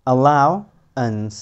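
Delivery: Allowance very slowly, split before the ending; broken into syllables. Shown as a demonstration. Teacher joins the sounds for learners, allowance slowly in parts. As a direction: draw out allowance part by part. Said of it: "যেটা এল এর সাথে আমরা মিলিয়ে পড়েছি".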